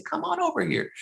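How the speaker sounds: high, friendly call across the playground. Retold or imitated. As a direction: call the boy over, lightly. Calling the boy over.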